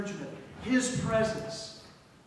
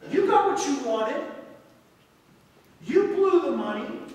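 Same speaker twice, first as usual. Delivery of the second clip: louder, higher accusing tone; resentful son; 5 semitones higher, 6 dB louder than usual. Acted. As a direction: throw it in the father's face. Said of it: "the son is saying".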